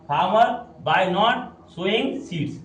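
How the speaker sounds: very slow, word by word; deliberate dictation pace; slow reading aloud. Shown as a demonstration. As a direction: read it out slowly for the class. Teacher reads sentence for class.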